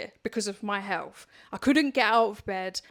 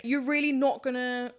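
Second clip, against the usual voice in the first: ordinary talk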